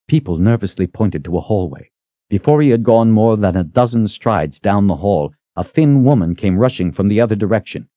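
usual speaking voice

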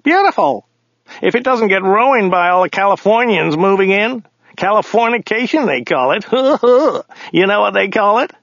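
high voice for the talkative driver, with a laugh; chatty driver. A narrator's reading. Driver joking to his passenger about Californians, high character voice. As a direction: chatter on high, pleased with the joke.

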